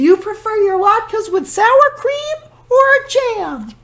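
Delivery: put-on high, sweet old woman; cheerful high granny voice; old grandmother voice. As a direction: play the kindly old granny talking to her guest. Baba Brena voice.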